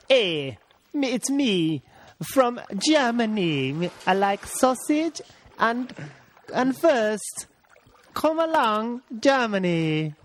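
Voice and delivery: high, slow, badly faked German accent. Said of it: "do a German one for me".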